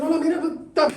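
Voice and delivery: high, loud, laughing seller voice; mock pushy seller. Speaker mimics saleswoman forcing perfume, high laughing.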